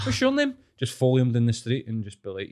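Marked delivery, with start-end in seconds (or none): 0.1-0.5 very high, put-on chat-up; mocking pickup line. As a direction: throw the pest's line back at him, pitched way up. Imagined confrontation mimicking the pest's line.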